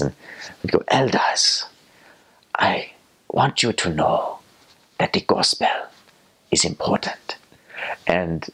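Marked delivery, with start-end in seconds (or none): 0.9-7.4 put-on slow, halting African-accented church leader; President Dubé. Church leader addressing the elders, slow accented impersonation. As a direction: impersonate a church leader, pausing between phrases. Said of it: "do our best President Dubé impersonations".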